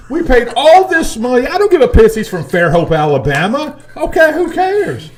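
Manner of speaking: ranting. Real, not acted. Fan ranting about the quarterback, loud and high. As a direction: vent about wasted money. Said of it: "Oh, my gosh".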